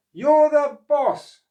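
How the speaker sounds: very high, loud called reminder; calling from the boat. Acted. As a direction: call back from the leaving boat. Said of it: "he called".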